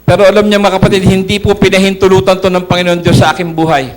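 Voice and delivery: loud rising testimony preaching; preaching at full force. Acted. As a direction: proclaim it to the congregation.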